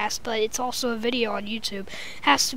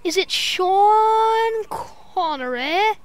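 slow drawn-out guess; 6 semitones higher, 4 dB louder, much slower than usual. Pretend. Guessing the accent's owner.

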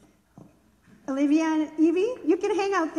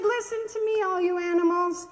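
higher, calling to the whole barn; 4 semitones higher, 3 dB louder than usual. Acted. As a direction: raise the voice to get everyone's attention. Pig calls the animals together.

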